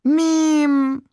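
long drawn-out, high, loud wail; wailing lament. A narrator's reading. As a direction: lament the name in one high, stretched moan. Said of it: "klagte er".

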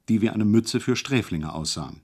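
usual speaking voice

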